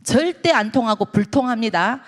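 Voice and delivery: ordinary talk, nothing marked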